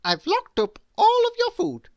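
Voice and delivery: very high, loud cheerful lady; cheerful bossy. A narrator's reading. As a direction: announce it brightly from on high. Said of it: "said Miss Bossy cheerfully".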